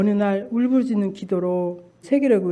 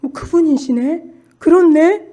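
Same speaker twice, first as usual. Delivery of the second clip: excited realization; 7 semitones higher, 8 dB louder than usual. Real, not acted.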